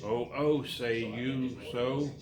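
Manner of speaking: ordinary talk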